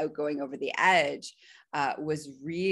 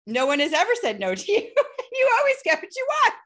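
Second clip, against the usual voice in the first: high, loud, fast, through laughter; laughing mock exasperation; 7 dB louder, much faster than usual. Master teasing the spoiled submissive, laughing mock exasperation. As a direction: tease in a rising, laughing rush.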